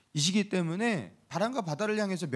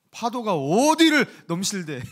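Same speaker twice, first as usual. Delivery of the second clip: loud, higher, drawn-out mock rebuke; hammed-up telling-off, stretching the key word slowly; mock scolding; 4 semitones higher, 8 dB louder than usual. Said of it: "막 이런 식으로 꾸짖은 거".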